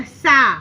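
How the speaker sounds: सा said loud with a clear non-nasal "aa"; open oral vowel. Shown as a demonstration. Teacher sounding out the syllable for children, सा loud and oral. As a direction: sound out सा firmly, nothing through the nose. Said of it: "उच्चारण क्या हो गया".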